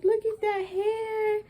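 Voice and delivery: very high, slow, tearful cry of joy. Real, not acted. Mother seeing her newborn's hair, crying with joy. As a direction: weep as the words break up high. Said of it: "I bawl, I start crying".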